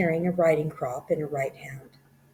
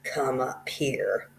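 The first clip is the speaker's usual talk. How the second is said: plain reading voice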